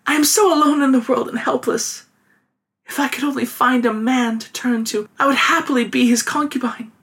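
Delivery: put-on high, plaintive young widow; young woman voice. A narrator's reading.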